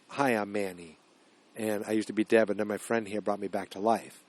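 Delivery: low, hushed retelling of the quote; quiet recheck. Retold or imitated. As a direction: murmur the line to yourself while working it out. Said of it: "he actually said to the little girl".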